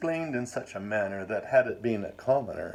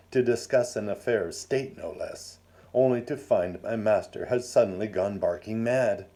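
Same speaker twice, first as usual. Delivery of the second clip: ordinary delivery, nothing marked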